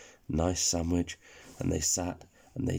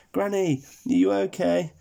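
worried character voice; 10 semitones higher, 6 dB louder than usual. Acted. Speedy Burger calls out to Granny.

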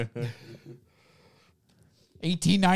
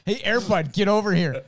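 louder, higher mock summons; calling a dog; 8 semitones higher, 6 dB louder than usual. Showing how you'd call Air Bud, raised calling voice.

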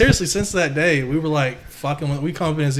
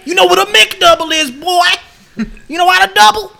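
much higher and louder mock coach voice; coach impression; 8 dB louder than usual. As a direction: ham up the goofy coach. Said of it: "He said".

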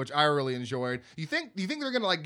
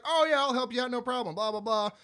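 higher-pitched, lightly voiced helpful guy; overly nice stranger; 7 semitones higher than usual. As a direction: offer help a touch too eagerly. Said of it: "this dude in a truck who's way too nice".

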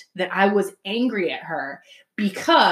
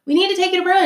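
more eager insisting; 11 semitones higher, 4 dB louder, much faster than usual. Retold or imitated. Repeated, higher and louder.

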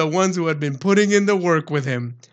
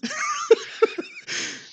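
high, breathy burst of laughter; cracking up at the punchline; 3 dB quieter than usual. Real, not acted. Laughing at the absurd story.

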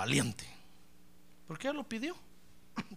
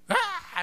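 a sudden high wail of grief; high anguished wail; wailing cry; 5 dB louder than usual. Retold or imitated. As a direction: let out a sharp cry of despair. Woman bursts into tears.

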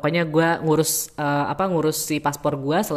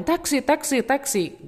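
taxi tout; 10 semitones higher, slower than usual. Retold or imitated. Taxi drivers offering rides.

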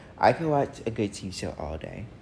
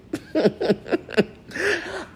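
a squeaky burst of laughter; high-pitched giggle. Real, not acted. Laughs about bingeing the show.

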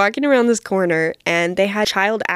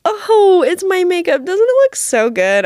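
excited; 9 semitones higher, 4 dB louder than usual. Retold or imitated. Excitedly showing off makeup.

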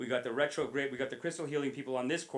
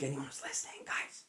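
whispering; 9 dB quieter than usual. Acted. Confiding aside to listeners.

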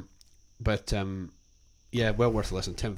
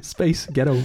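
laughing through the words; giggling American accent try; 3 semitones higher, 4 dB louder, slower than usual. Shown as a demonstration. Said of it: "in an American accent".

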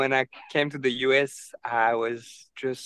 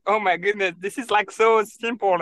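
higher, exasperated disbelief; 7 semitones higher than usual. Retold or imitated. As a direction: act baffled at an obvious mistake. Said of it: "And I'm like".

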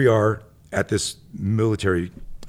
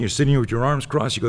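ordinary talk, nothing marked

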